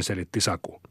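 plain reading voice

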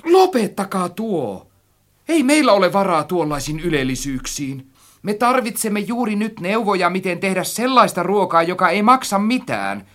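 high, loud, alarmed protest; horrified shouting. A narrator's reading. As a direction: plead loudly in alarm, pitched high. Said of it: "huusin kauhuissani".

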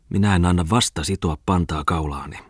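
ordinary delivery, nothing marked

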